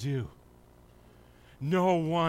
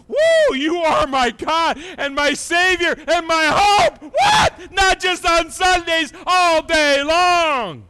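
high, loud, slow jubilant cry; joyful shout; 11 semitones higher, 13 dB louder, slower than usual. Acted. Psalmist praising God all day, joyful shout. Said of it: "And he said".

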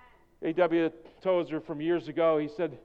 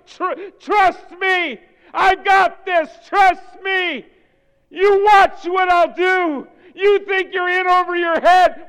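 loud, high shouted voice of God; preaching at full force; 11 semitones higher, 11 dB louder, slower than usual. Acted. Preacher shouting God's words to the congregation, booming. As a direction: thunder it out as God speaking to his people.

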